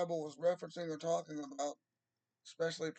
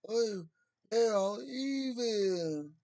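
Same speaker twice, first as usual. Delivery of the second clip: slow, drawn-out mock decree; slow exaggerated mock-royal pronouncement; mock scholarly king; 3 semitones higher, 4 dB louder, much slower than usual. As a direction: voice a pompous old king, very slowly.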